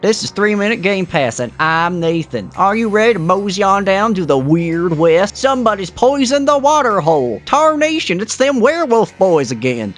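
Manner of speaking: hammed-up Wild West prospector; old prospector. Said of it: "I'm not doing this voice".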